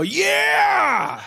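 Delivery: loud joyful whoop; hammed-up drunken cheer; excited shout. Congregant shout retold.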